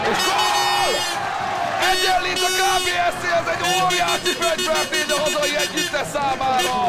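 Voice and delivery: excited goal call. Real, not acted. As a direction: shout the equaliser. Equaliser scored.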